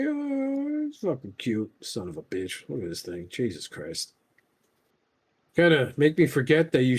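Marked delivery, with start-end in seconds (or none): 0.1-4.1 soft awed swearing. Real, not acted. Overwhelmed by a cute toy.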